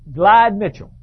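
usual speaking voice

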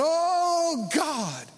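OH held long and high, GOD falling away; emphatic pleading. Retold or imitated. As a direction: stretch "Oh" into a high mock plea. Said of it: "put emphasis on different words".

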